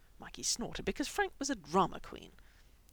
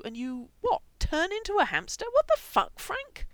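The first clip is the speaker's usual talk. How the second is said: incredulous shout; 13 semitones higher, 10 dB louder than usual. A narrator's reading. Brother yelling at Frank in high, loud outrage. Mikey confronts Frank, disbelieving.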